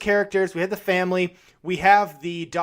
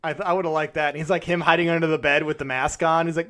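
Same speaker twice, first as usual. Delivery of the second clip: ordinary talk, nothing marked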